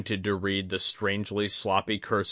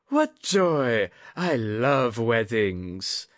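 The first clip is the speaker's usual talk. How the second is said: put-on gushing old lady; 3 semitones higher, 4 dB louder, much slower than usual. A narrator's reading. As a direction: gush like a sly old noblewoman.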